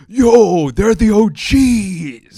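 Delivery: loud, high, slow street slang; hyped-up gangster drawl. Retold or imitated. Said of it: "using gangster, gangster language".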